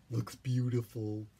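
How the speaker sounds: ordinary delivery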